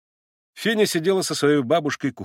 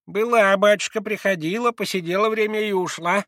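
put-on high old woman's voice; old servant woman; 9 semitones higher, 3 dB louder than usual. A narrator's reading. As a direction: tell him plainly as a humble old maid.

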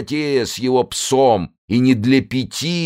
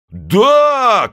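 high, loud shouted yes; chorus shout; 11 semitones higher, 6 dB louder than usual. Acted. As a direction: yell yes as one. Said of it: "крикнули крестоносцы любви".

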